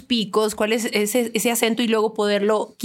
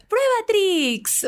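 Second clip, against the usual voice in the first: much higher, very slow drawn-out tagline; kids ad tagline; 11 semitones higher, much slower than usual. Shown as a demonstration. Closing the cereal ad demo for the hosts, high and slow. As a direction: stretch the slogan like a cartoon announcer. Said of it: "¿Cómo dice, por ejemplo, el comercial?".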